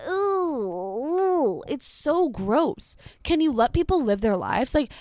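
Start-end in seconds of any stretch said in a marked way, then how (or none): 0.1-3.0 high, drawn-out "ooh" of exaggerated revulsion; hammed-up grossed-out whine; mock disgust. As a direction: put on a high, slow squeal of distaste. Mocking the poster's disgust at food.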